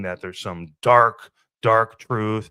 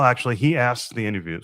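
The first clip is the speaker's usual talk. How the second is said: normal talking voice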